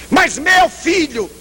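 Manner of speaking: mother refusing to accept the warning; pleading protest. Retold or imitated. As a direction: drag it out, refusing to believe.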